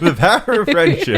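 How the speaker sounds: high, loud laughing cheer; a laughing shout of triumph. Real, not acted.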